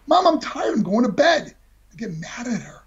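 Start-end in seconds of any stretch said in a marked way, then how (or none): 0.1-1.6 put-on annoyed teen. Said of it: "I go".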